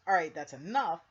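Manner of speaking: loud, sharp retold shout; retold yell. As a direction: bark it out as she loses her temper. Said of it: "when she yells".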